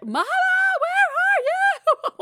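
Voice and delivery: put-on child calling. Kid calling for mama from outside the bathroom, high and loud. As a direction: wail for mama like a small child.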